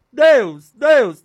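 two loud, high calls of "Deus". Shown as a demonstration. Preacher acting out a loud cry to God, then rejecting it. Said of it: "não, não".

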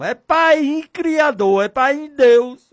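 high, loud, stretched-out invocation; fervent calling. Shown as a demonstration. Preacher shows how he addresses God as father, fervent calling.

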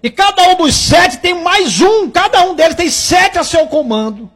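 forceful preaching. Real, not acted. Preacher warning sufferers that they feed the devil, forceful preaching. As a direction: preach it with rising urgency, driving the key words.

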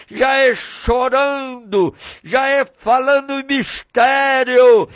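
impassioned pulpit delivery; fervent preaching. Real, not acted. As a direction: proclaim it with mounting fervour, stretching the endings. Preaching at full force.

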